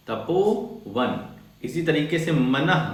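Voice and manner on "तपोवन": तपोवन stretched out slowly, syllable by syllable; slow demo. Shown as a demonstration. Teacher spelling out तपोवन slowly for students. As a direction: draw out तपोवन for the class. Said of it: "तो तपोवन".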